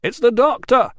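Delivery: high, loud answering shout. A narrator's reading. Rousseau shouting back to the trapped man. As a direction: call out so he can hear. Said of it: "shouted Rousseau".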